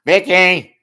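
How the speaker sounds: loud call across the room. Real, not acted. Host calling loudly to Vicky in the chat. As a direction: shout her name to get her attention.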